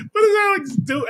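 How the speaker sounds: cracking up high; squealing with laughter; laughing hard. Real, not acted. Bursts laughing at story.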